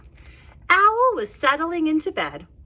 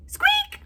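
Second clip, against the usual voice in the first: tiny high squeak; high mouse squeak. Acted.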